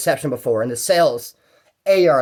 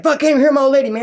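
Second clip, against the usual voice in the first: higher, louder fed-up cursing; frustrated outburst; 9 semitones higher, 6 dB louder, faster than usual. Retold or imitated. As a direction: vent at the broken call. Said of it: "He's like".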